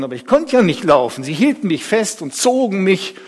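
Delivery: ordinary delivery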